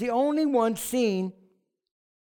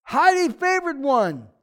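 angel's announcement; 5 semitones higher, 6 dB louder, slower than usual. Acted. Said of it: "He says".

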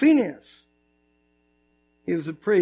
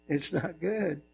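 even softer, sinking low; softer still; 4 semitones lower, 11 dB quieter, slower than usual. Shown as a demonstration. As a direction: let it fade to a hush.

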